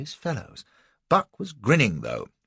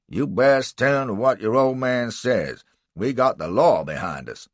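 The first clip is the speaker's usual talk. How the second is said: gruff slave catcher; 3 semitones higher, 6 dB louder than usual. Acted.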